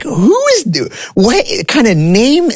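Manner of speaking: high, slow, baffled squeak; a shocked teen who can't believe his ears; stunned disbelief. Retold or imitated. Retold shock at a new name.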